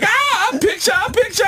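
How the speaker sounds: very high put-on voice of the tourists; imitating tourists asking for a picture; mock tourist voice. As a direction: squeak it like chirpy tourists posing.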